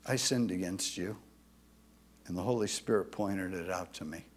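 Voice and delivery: humble apology. Retold or imitated. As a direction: quietly confess and ask pardon. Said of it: "I can go to them and say".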